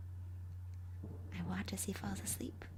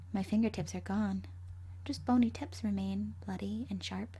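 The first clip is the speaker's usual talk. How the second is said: ordinary delivery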